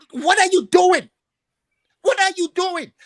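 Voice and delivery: loud, high-pitched outburst of disbelief; exasperated shout. Real, not acted. Caller venting at the host about Liverpool, an exasperated shout. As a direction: yell it at the club in frustration.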